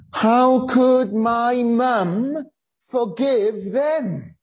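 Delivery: slow, high, pained outcry of a daughter; retold anger. Daughter hearing her mum forgive, slow and pained. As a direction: ask it slowly, stung and disbelieving. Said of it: "she was so angry".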